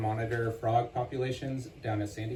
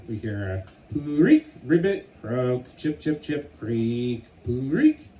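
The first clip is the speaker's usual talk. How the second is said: frog call imitations; 4 dB louder, slower than usual. Narrator imitating frog and creek sounds.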